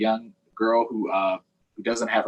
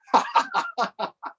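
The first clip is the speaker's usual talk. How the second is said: Leon's high mocking laugh; mocking burst of laughter. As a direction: cackle at the drawing. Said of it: "Leon burst out laughing".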